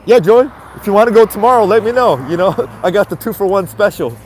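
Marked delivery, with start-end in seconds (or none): none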